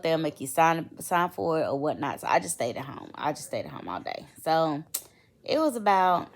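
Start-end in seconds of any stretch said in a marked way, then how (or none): 3.1-4.3 softer, lower afterthought; quieter repeat. Real, not acted. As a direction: drop the voice as you repeat it. Repeating herself, softer take.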